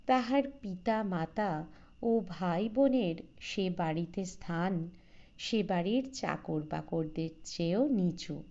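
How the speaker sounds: ordinary delivery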